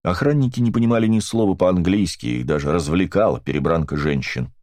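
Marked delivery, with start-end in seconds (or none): none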